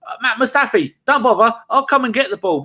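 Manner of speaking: high, bossy keeper voice; lightly voiced goalkeeper calling it; mock Leno. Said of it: "Leno goes".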